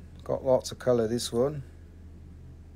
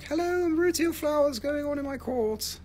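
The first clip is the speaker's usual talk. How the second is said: much higher, cartoonish greeting; talking quartz voice; 13 semitones higher than usual. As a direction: squeak it as if the quartz itself is talking.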